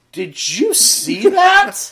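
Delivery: high, slow, amazed question; wide-eyed high drawn-out disbelief; stunned disbelief. Retold or imitated. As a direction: ask it like you can't believe your eyes. Pastor reacts to rat entering hotel.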